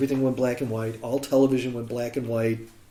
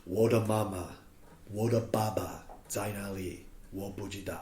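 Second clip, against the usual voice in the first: solemn; 4 semitones lower, 9 dB quieter than usual. Retold or imitated. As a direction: mourn each word quietly. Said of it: "very solemnly saying".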